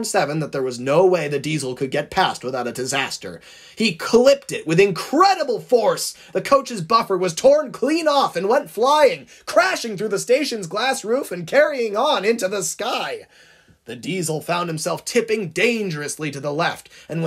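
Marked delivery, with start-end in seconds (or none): 3.8-13.3 dramatic, higher-pitched narration at the climax; high, punchy action storytelling; excited story narration. A narrator's reading. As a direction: narrate the crash with mounting urgency. Narrates the crash at full excitement.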